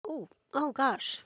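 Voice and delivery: coughing after a sip; choking on a drink. Real, not acted. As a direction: drink gone down the wrong way. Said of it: "that went down the wrong pipe".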